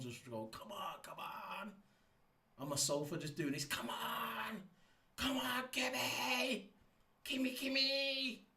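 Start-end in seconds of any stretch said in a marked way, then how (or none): 0.5-1.7 high, drawn-out cheer, held back in volume; going mad. Retold or imitated. Fan on the sofa urging on the driver, mock-frantic. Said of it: "I was going mad". 3.6-8.5 very high, drawn-out fan cheering; going mad. Retold or imitated. Fan on the sofa cheering Kimi on, going mad. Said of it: "I was going mad".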